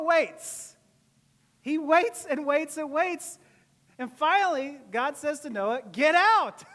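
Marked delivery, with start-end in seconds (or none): 5.9-6.6 loud, high sudden order; commanding shout. Acted. God telling hesitant Noah to leave, commanding shout. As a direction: burst out with a booming command.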